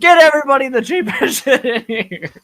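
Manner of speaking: high, loud call with a laugh breaking in; loud laughing order. Acted. Ordering cheap drinks for everybody, loud and laughing. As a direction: shout the order across the bar, laughing.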